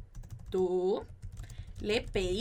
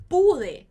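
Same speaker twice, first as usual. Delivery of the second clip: pude punched much louder, PU hit hard; stress on pude; 5 semitones higher, 10 dB louder than usual. Shown as a demonstration. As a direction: slam the syllable PU in pude. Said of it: "ele se tornou pu". (ênfase forte no pu de pude)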